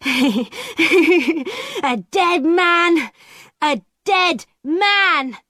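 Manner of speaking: high, very slow taunting chant; girl calling a curse after the peddler; cursing call. A narrator's reading. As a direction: draw out the curse like a witch. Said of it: "she called".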